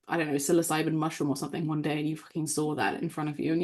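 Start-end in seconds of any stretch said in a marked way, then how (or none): none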